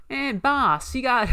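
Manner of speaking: a cheeky mob henchman talking to his boss; comedy gangster voice. Retold or imitated. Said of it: "he's that guy in, like, a gangster movie".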